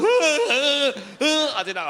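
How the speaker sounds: high, slow laughing sob; words squeezed out through laughter; laugh-crying. Retold or imitated. Overwhelmed man laughing through tears on Zoom.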